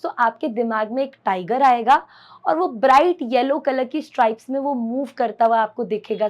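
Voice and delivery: ordinary delivery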